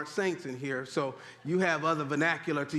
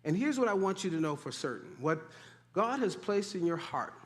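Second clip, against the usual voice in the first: ordinary talk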